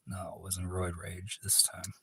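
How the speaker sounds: ordinary talk, nothing marked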